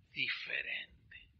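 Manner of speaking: slow, whispered key word; hushed emphasis. Real, not acted. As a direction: drop to a drawn-out whisper. Preacher drops voice for key word.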